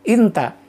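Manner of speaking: "Inta" with a short clear i, stressed first syllable; dialect pronunciation. Shown as a demonstration. Teacher shows students the dialect word for you, pronunciation demo. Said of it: "Jadi inta nyebutnya itu".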